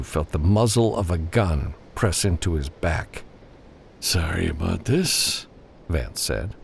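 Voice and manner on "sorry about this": a hint of a regretful gunman; lightly voiced captor. A narrator's reading. Vance voiced holding a gun.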